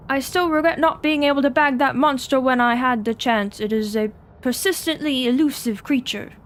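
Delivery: put-on British explorer's accent; Lord Blackwood. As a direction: reminisce as a British lord, strongly voiced. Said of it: "Should I attempt a British accent".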